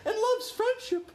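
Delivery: put-on squeaky falsetto girl caricature; very high, mocking cartoon girl, laughter creeping in; Taya. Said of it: "Taya's the girl one".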